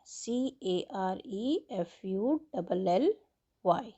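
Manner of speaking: letters spelled out one by one, very slowly; slow spelling. Shown as a demonstration. Teacher spelling carefully for students, slow letter by letter. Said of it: "teacher spells word letter by letter".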